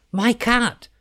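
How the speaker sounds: high-pitched outraged cry; storyteller's witch crying out; witch calling out. A narrator's reading. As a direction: call out high as the upset witch. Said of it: "she called out to the children".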